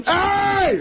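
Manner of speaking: high-pitched mimicked holler; Tracy Morgan calling out. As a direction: yelp for attention in a high put-on voice. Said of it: "he goes".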